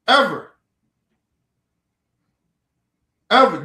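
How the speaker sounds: loud, falling one-word outburst; angry emphasis. Real, not acted. Cutting off whoever called him an alcoholic, loud and angry. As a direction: land it hard, like a final warning. Angry ultimatum to stop calling.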